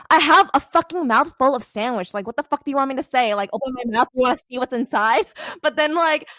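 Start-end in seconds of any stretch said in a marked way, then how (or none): 0.1-5.3 indignant retort. Retold or imitated. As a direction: snap back with a mouth full of sandwich. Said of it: "And I'm like".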